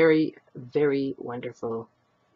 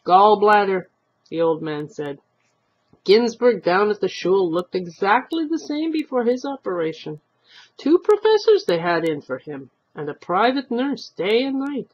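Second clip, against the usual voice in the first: put-on chatty old man; 5 semitones higher, 6 dB louder than usual.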